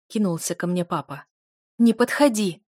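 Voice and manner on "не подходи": loud, higher-pitched warning; raised-voice furious command; angry snarl. A narrator's reading. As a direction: order him back in anger. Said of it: "зарычала я на него".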